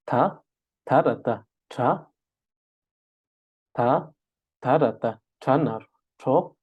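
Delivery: syllable-by-syllable spelling for the class to repeat. Shown as a demonstration. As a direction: spell "Ta, tarata, tra" in clear separate beats. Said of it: "đánh vần theo thầy".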